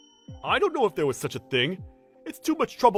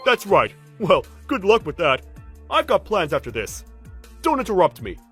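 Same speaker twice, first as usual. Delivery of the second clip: loud, higher cocky brush-off; dismissive; 5 semitones higher, 8 dB louder than usual. Acted. Senior leaving for plans after work, dismissive. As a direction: wave them away, annoyed.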